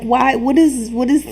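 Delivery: ordinary talk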